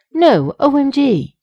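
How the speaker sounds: high, loud, drawn-out protest; mock outrage. Shallot messages Sheske about the ending, loud outraged protest. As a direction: wail it like an appalled fan.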